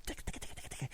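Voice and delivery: very soft, voiceless rapid ticking; imitated typing. Host mimics his quick typing to the voice actor, whispered ticks. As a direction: tick away like busy keyboard keys. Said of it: "I was typing quick like a bunny".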